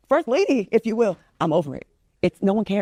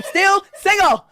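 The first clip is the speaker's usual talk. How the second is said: laughing through the words, pitched up; 10 semitones higher, 3 dB louder, much slower than usual. Real, not acted. Guest laughing to the host about still being single. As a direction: break into a laugh mid-line.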